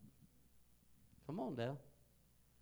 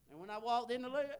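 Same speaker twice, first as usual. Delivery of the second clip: normal talking voice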